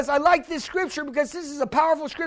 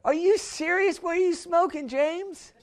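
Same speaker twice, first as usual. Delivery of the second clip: mock shock, higher pitch; incredulous disbelief; 4 semitones higher, 4 dB quieter than usual. Pastor mocks his own reaction to James, incredulous. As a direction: react as if the verse is absurd. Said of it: "I'm like".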